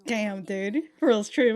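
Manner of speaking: usual speaking voice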